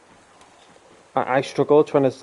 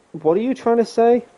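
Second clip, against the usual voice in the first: much higher, rising mock voice; imitated baffled question; 11 semitones higher than usual. Streamer imitating a friend who overheard the mispronunciation, high and baffled.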